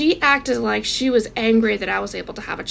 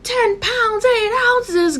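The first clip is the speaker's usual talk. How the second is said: much higher, loud shocked shout; shocked yell; 11 semitones higher, 4 dB louder, much slower than usual. Retold or imitated. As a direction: blurt the weight out in disbelief. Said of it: "they both yelled".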